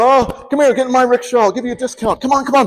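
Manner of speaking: high-pitched, excited street hawkers; eager touting drivers. Retold or imitated. Rickshaw drivers clamour for a tourist's business, eager touting. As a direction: imitate drivers calling out for a fare.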